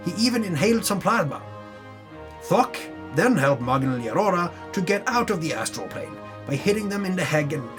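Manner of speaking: ordinary talk, nothing marked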